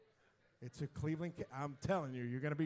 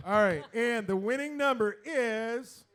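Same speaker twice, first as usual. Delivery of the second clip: raffle caller; 3 semitones higher, 7 dB louder, slower than usual. Acted. Announcing raffle winner.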